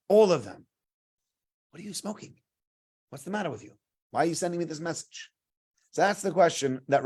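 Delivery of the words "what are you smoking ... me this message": soft, incredulous brush-off; lightly acted, hushed bewilderment; baffled dismissal. Esav answering Yaakov's message, dismissive.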